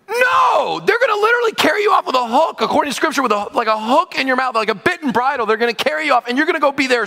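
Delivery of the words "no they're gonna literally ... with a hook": high-pitched, shouted objection; exclaimed protest. Acted. Onlooker objecting to the false offer, high shout. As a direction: burst out in disbelief, high and fast. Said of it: "Anybody from the outside looking in goes".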